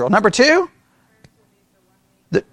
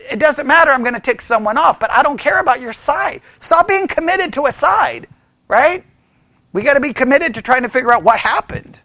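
usual speaking voice

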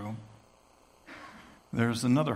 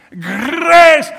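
"Grace" with a rolled Scottish or Irish r, much higher and loud; 13 semitones higher, 19 dB louder than usual. Shown as a demonstration. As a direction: proclaim "Grace" in a Celtic brogue. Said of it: "you can't say it except in Scottish or Irish".

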